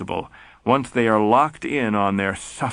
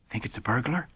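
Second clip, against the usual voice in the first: a hushed whisper, much softer; whispered question; 9 dB quieter, faster than usual. Acted. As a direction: whisper so the stranger won't hear. Suspicious shape in the dark.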